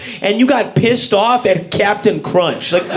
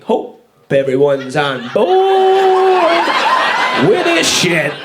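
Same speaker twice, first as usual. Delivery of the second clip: mock bold announcer; much slower than usual. Guillotine guy quote.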